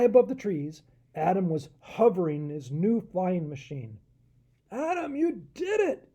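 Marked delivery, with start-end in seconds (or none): 4.7-6.1 high, delighted shout; joyful yell. Acted. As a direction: cheer him from the ground. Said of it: "King Aaron yelled".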